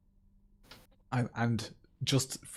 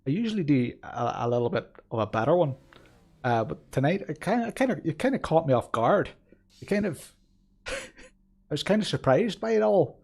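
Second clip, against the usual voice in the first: put-on halting, lilting amateur Belfast accent. Streamer introducing himself and his car, Belfast accent. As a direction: put on a wobbly Belfast accent, searching for words. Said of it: "I think my Belfast accent is actually".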